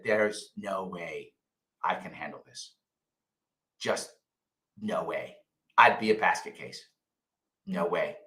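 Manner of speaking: retold inner voice, soft and low; quiet resigned self-talk. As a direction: murmur it to yourself, defeated. Retelling his own inner verdict.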